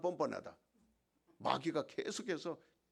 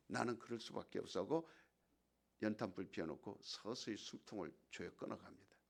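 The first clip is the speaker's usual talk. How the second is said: ordinary talk, nothing marked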